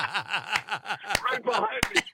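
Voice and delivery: can barely get the words out for laughing. Real, not acted. Laughing at his own mix-up, breaking up mid-line.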